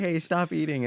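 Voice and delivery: laughing through a mock sleep-talk call. Retelling sleep-talk to a friend, laughing. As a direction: giggle it out like a kid talking in his sleep. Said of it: "I'm like".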